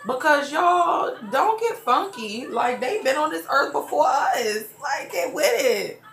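high, loud exhortation with a laugh; fired-up shout. Real, not acted. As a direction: call the viewers out to respect their elders. Urging viewers to respect parents.

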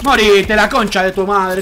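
loud furious curse; angry yell. Real, not acted. Gamer cursing at enemy.

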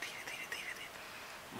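breathy hushed whisper, barely voiced. Real, not acted. Uncle hushes near sleeping toddler, soft whisper. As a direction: whisper so she won't wake.

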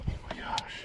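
soft, breathless whisper; stunned whisper. Real, not acted. Angler overwhelmed by his catch, stunned whisper. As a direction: whisper it, spent from screaming. Overwhelmed after landing the giant.

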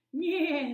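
high loud neighing yeah; whinny it like a horse. Acted. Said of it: "said the horse".

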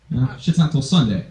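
ordinary talk

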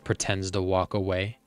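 ordinary delivery, nothing marked

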